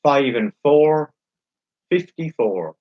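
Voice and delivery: slow, drawn-out bingo calling; bingo caller. Acted. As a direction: call each number slowly, then repeat it.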